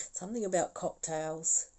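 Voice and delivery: real laughter at the cocktails message; laughing. Real, not acted. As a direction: laugh through the line.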